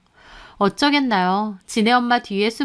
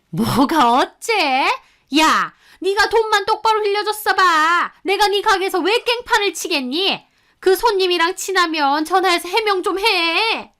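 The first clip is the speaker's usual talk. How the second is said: angry mother-in-law; 9 semitones higher than usual. A narrator's reading. Mother-in-law blaming daughter-in-law about money, furious high scolding. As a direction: berate her like it's all her fault.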